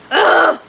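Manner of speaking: loud, high groan of frustration; frustrated groan. Real, not acted. Streamer groans as tabs won't fit, loud and fed up. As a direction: let out a fed-up ugh.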